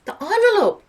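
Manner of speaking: high, loud eureka cry; sudden realisation. Acted. Meg realising the envelope is missing, high excited cry. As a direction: it just clicked.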